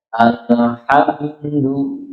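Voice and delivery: very slow chanted "alhamdu", tongue tip shifted on l; bent tongue l. Shown as a demonstration. Teacher demonstrating the tongue shift, very slow "alhamdu". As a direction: stretch "alhamdu", sliding the tongue aside on l. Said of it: "bisa geser ujung lidahnya".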